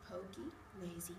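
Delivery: hushed storytelling whisper. Acted. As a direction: softly breathe the names. Repeating pig names in a hush.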